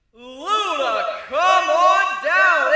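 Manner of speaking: high, loud, slow drawn-out announcer call; game show call. A narrator's reading. Host calling Luna onto the stage, booming announcer call. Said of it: "It's your turn!".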